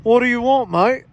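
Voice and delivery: put-on rough try at an Australian accent. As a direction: play a put-on Aussie shopkeeper. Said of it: "He's not Australian".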